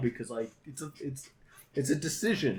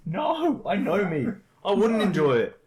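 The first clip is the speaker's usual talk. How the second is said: loud, high-pitched insistence; hammed-up protest; exasperated refusal; 8 semitones higher, 7 dB louder than usual. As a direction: dig your heels in loudly. Acted quarrel, refusing friend's hobby.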